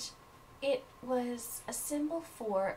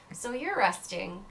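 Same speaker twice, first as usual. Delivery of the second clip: usual speaking voice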